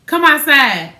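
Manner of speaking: loud, high calling out; loud, high call from the yard; retold call out. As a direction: shout it down the phone to come outside. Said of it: "He on the phone".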